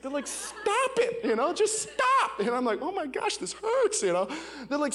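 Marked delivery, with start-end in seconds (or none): 0.3-2.4 much higher, pleading exasperation; high-pitched imitated protest; exasperated mock rebuke. Staff's acted rebuke, retold. 2.8-4.0 high, wincing inner voice; hammed-up little wail; mock pained reaction. Acts own pained inner reaction.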